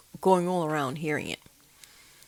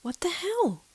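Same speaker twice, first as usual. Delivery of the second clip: high, startled; alarmed exclamation; 10 semitones higher, slower than usual. Retold or imitated. Hearing the steps again, high startled cry. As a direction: blurt it out high in shock. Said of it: "I'm like".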